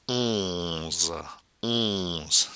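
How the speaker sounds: "onze" with a long nasal vowel; nasal pronunciation. Shown as a demonstration. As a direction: hold "onze" in the nose, then release the z. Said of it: "Nasal sound".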